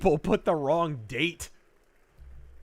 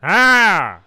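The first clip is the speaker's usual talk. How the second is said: loud sudden ah; loud exclamation; 2 semitones higher, 10 dB louder than usual. Real, not acted. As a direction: blurt it out. Reacting loudly.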